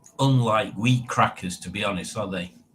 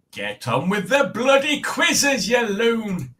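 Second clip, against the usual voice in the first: higher, slow mock shout; mock exasperation; 9 semitones higher, slower than usual. Telling the host off to get on with quizzes, mock shout. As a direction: bellow it in fake impatience.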